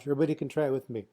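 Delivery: normal talking voice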